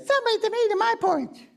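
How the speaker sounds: very high, quick little cub voice; Baby Bear. Shown as a demonstration. Performer shows Baby Bear the line, high little voice. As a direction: squeak it out like a tiny bear cub. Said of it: "In your little Baby Bear voice".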